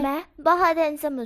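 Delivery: loud boast after a slap; forceful boast. Acted. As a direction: hammer home how handsome he is. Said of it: "जोरदार चाटा मारता है और बोलता है".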